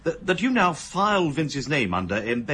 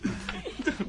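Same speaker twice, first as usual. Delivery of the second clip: breathy giggle; 7 dB quieter than usual. Real, not acted. Inspector delighted by card game.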